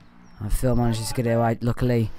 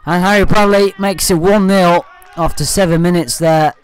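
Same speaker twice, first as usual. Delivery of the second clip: excited; 5 semitones higher, 5 dB louder than usual. Real, not acted. Commentator calls home team goal.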